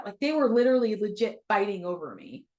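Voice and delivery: ordinary talk, nothing marked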